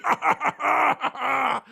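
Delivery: triumphant taunting cackle; mocking cackle. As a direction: laugh them down like a maniac. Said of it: "and goes".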